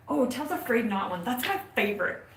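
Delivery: ordinary talk, nothing marked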